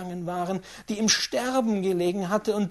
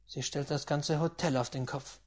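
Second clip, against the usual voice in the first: plain reading voice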